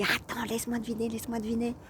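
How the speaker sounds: high, rushed plea to keep guessing; acted-out eager guessing, quick and high; eager guesser. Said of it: "Et donc ce gars, aujourd'hui".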